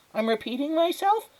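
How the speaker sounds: very high, startled question; alarmed neighbour. Acted. Neighbour startled by her repetition.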